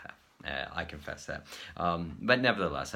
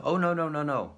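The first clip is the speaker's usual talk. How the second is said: high, louder rapid "no, no, no"; high insistent denial; emphatic no; 9 semitones higher, 6 dB louder than usual. Retold or imitated. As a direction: brush the idea off eagerly. Answering visitors' question.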